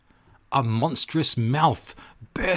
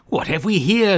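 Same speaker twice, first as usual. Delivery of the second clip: raised, wondering octopus voice; a hint of a staring octopus; curious octopus; 8 semitones higher, 5 dB louder than usual. Octopus greets the cork and butterfly, curious and raised.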